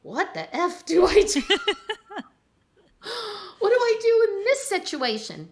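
hammed-up parental panic; overwhelmed new parent. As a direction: throw up your hands at the chaos of parenting. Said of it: "I was like".

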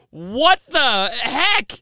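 slow, drawn-out what the heck; stunned. Retold or imitated. Soldiers stunned by the impaled prisoners at the castle. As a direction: gape at the sight, slowly. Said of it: "they're like".

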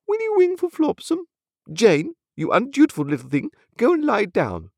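high, imperious lady; high-pitched lofty command; haughty hostess. A narrator's reading. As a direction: give orders to guest and child with affronted dignity. Haughty mother gives orders.

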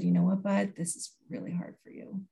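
quiet, gentle reassurance; mother gently soothing her impatient son, quietly; soft calm to son. Retold or imitated. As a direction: speak softly to calm an upset boy. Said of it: "And I just said".

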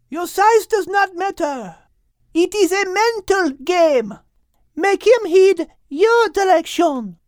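high, urgent coaching call; yelling instructions. A narrator's reading. Liz yelling instructions to Max. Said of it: "began yelling instructions".

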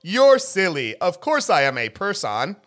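robocall voice. Retold or imitated. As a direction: play a chirpy phone robot. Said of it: "one of those robots".

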